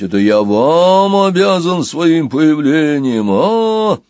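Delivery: slow, loud, booming monster; booming giant voice. Acted. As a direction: thunder it out like a huge bell. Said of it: "прогремел голос".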